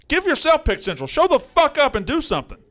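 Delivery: high, loud furious outburst; scolding a cohost who never shows up. Real, not acted. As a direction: snap it out, fed up. Angry rant climax.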